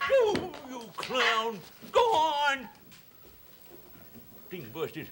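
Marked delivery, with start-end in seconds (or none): 0.1-2.7 higher and slowly drawn-out oh you clown; hammed-up slow exclamation at the prankster; exasperated exclamation. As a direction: stretch the oh out in mock outrage. Clown squirts him again.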